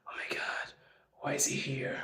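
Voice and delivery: very soft, hushed imitation of gossip; mock-shocked whisper. Immigrants gossiping on seeing him, whispered. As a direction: whisper it behind his back, scandalised.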